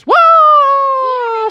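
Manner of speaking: long high "whoa" of a cliff jumper; mock yell trailing away in a fall; high, sustained falling cry. Character leaping off cliff.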